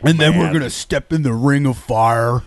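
sing-speak it like [name]. Pretend. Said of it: "Try [name] now".

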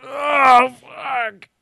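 strained groan mid-stretch; groaning while stretching. Real, not acted. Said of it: "Sorry, I'm stretching right now".

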